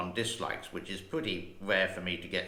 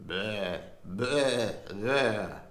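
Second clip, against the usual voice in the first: warming up voice; much slower than usual. Shown as a demonstration. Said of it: "I got to put in my Winston Churchill accent".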